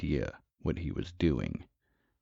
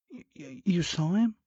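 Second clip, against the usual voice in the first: high, stuttering woman's voice; put-on female voice, shocked stammer; alarmed wife; 14 semitones higher than usual.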